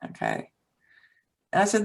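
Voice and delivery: ordinary talk, nothing marked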